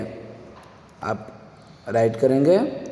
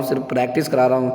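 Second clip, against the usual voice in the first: normal talking voice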